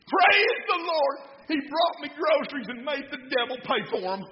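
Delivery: high, loud, triumphant hollering; overjoyed church lady shouting praise; jubilant shout. Acted. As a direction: crow it out in sheer delight. Jumping lady rejoices triumphantly.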